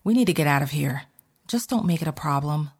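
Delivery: usual speaking voice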